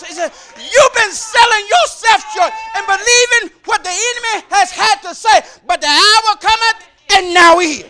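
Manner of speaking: refrain climbing from firm to a loud shouted cry; building preaching. Acted. Preacher to the congregation, building from firm to a full shout. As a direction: preach it harder each time until the hall rings.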